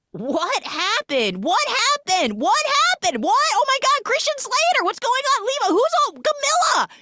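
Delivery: very high, loud mock panic; total freak-out. Man looking around after letting go, freaking out wildly. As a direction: shriek the questions in hammed-up bewilderment. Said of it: "He starts having a total freak out".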